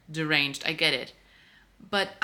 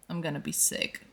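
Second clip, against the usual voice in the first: breathy sick groan; 5 dB quieter than usual. Real, not acted. Sighing in distress.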